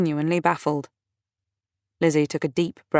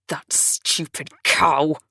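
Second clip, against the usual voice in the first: savage; much slower than usual. A narrator's reading. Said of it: "with a savagery that shocked her".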